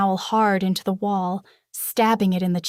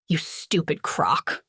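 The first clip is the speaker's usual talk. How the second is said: slow, lower contemptuous snarl; 3 semitones lower, slower than usual. A narrator's reading. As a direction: sneer it down at him. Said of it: "he snarled".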